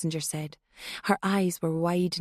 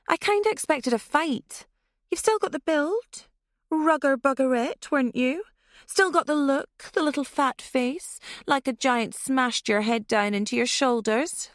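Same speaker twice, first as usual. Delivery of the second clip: higher, mocking taunting; sneering taunts; 7 semitones higher than usual. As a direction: jeer at her looks. Passenger taunts the terrified driver.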